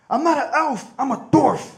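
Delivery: high, loud indignant snap; angry little man. Retold or imitated. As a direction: bark the correction back at him. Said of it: "He says".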